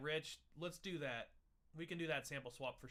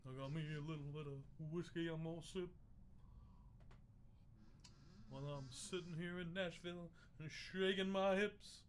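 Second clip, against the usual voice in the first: bad [name]; 3 dB quieter than usual. Introducing himself as drink pro, mock bad [name]. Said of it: "bad [name]".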